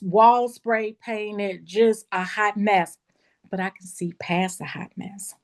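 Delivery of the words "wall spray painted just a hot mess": slow emphasis. Real, not acted. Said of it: "So I'm telling you".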